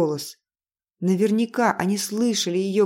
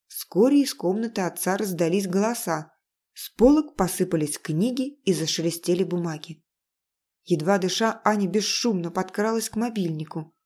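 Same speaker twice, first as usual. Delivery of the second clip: ordinary delivery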